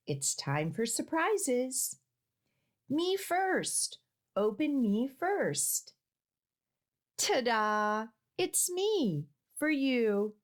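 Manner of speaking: lively picture-book reading with character voices; reading to children. A narrator's reading. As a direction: read the animals' questions playfully.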